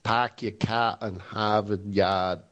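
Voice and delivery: slow, low Boston accent, dropped Rs; put-on broad Boston accent. Shown as a demonstration. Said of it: "like, in a Boston accent".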